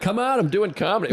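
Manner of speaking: high, pleading protest; young comic pleading with his girlfriend; mock pleading. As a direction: beg her to see it your way.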